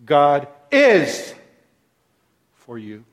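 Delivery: is leaned on, for you trailing off; stress on is. Shown as a demonstration. Preacher stressing is to his listeners. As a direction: press on the word is. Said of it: "Second word, is".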